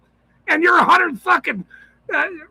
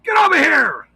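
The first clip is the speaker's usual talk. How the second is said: shouted command; 3 semitones higher, 3 dB louder than usual. Retold or imitated. Shouting at a suspect.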